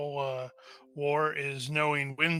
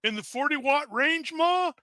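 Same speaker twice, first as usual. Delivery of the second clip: much higher, incredulous son; high questioning voice; 13 semitones higher, 4 dB louder than usual. Acted. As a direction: squeak up in disbelief. Character teases mother.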